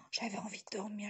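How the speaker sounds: breathy, airy re-enactment of sleepiness; gasp, then half-whisper it as if worn out; mock drowsiness. Vlogger recalling a grey, rainy day, mock drowsiness.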